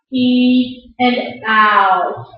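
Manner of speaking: "E" and "ow" very slow, each sound held; drawn-out vowel demo. Shown as a demonstration. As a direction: separate "E" from the gliding diphthong "ow", stretching both. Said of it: "that's our diphthong".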